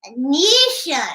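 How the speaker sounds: ventriloquist dummy voice; puppet voice. Acted. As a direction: talk through the puppet. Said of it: "as ventriloquist".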